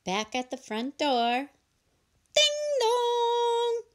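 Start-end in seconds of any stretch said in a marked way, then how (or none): 2.3-3.8 sung doorbell. Acted. Doorbell rings.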